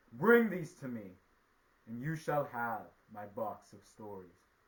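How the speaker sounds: loud decree, biggest at the start; commanding sky god. A narrator's reading. Sky god setting the spider his task, loud command. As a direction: order it like a mighty ruler.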